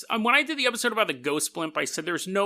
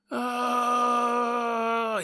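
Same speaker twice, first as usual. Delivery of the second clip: long held, higher-pitched drone; imitated falling noise; 4 semitones higher than usual. Man falling from a burning plane, long imitated wail. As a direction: hold one unbroken mock falling wail.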